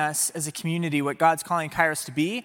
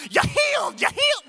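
very high, shouted healing parody; mock faith healer. Preacher parodying going around healing people, high mock shout. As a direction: holler like a hammed-up healer.

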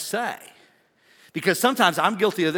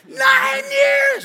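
much higher, louder outraged cry; indignant shout; 9 dB louder than usual. Retold or imitated. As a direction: shout the years in disbelief. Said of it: "They'd be like".

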